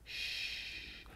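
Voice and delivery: soft breathy hush, finger to lips; calm shush. Shown as a demonstration. Telling a cop to hush, calm and quiet. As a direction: hush him gently, then drive off. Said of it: "Just very calmly take your finger".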